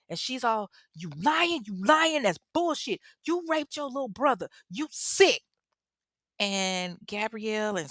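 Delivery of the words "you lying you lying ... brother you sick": high-pitched, punchy outraged mom; imitated furious accusation. Mother refusing her son's denial, angrily accusing. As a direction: act out a mother railing at her child.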